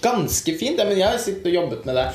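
high-pitched, affronted question; indignant echo. Acted. Writer to his sister about his text, wounded protest. Brother challenges faint praise.

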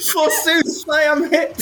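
high, hammed-up theatrical death cry; hammy stage actor. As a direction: overplay a Shakespearean dying hero. GM joins the mock Shakespeare death.

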